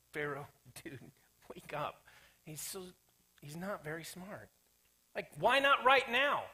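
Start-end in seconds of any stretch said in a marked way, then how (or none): 0.1-4.5 soft, conspiratorial whisper; a hushed, amused aside about Pharaoh. Real, not acted. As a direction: let them in on a secret joke.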